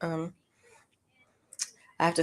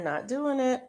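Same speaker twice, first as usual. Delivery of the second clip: higher-pitched mock self-denial; self-talk refusal; 8 semitones higher than usual. Shopper resisting a deal, playful high-pitched refusal. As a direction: playfully cut off your own temptation. Acted refusal after temptation.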